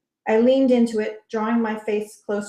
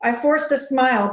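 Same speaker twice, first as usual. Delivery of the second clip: ordinary delivery, nothing marked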